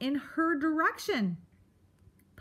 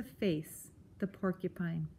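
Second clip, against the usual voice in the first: whispered; 4 semitones lower, 6 dB quieter than usual. A narrator's reading. Terrified whisper at climax.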